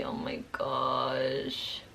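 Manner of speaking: low, slow whispered sigh; exhausted whisper. Real, not acted. Girl remembering the dentist, whispering low and drained. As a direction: let it out on a breath, drained. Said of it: "trying to calm down".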